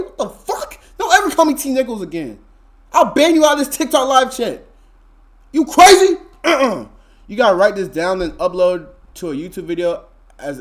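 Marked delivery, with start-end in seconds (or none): 0.0-0.8 breathless disbelief. Real, not acted. Outraged reaction to chat comment. 1.0-6.9 much higher and louder, playful threat; higher, louder play-angry rant at a viewer; mock-outraged scolding. Real, not acted. Threatening to ban a chatter.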